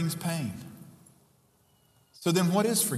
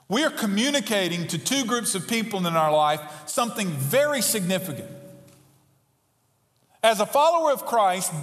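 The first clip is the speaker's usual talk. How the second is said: louder, higher, driving sermon voice; fervent pulpit delivery; preaching at full force; 9 semitones higher, 4 dB louder than usual. Acted. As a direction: preach it hard to the congregation.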